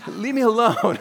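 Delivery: laughing through a mock teenage whine; teen snapping at mom through a laugh; sulky teen, laughing. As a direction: play the moody teen for a laugh. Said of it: "said that to your parents when you were a teenager".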